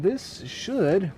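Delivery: should pushed up high; stress on should. Shown as a demonstration. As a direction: lean hard on "should". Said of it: "emphasis on should".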